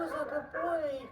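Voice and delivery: high, slow sing-song coaxing. Real, not acted. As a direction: coo at the puppy. Praising the dog.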